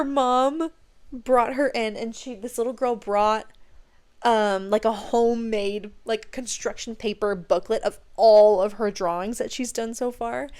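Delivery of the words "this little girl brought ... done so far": normal talking voice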